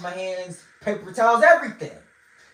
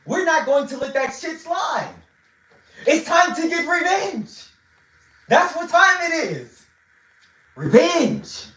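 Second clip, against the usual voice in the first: rallying cry; 8 semitones higher than usual. Real, not acted. Rallying call for revenge.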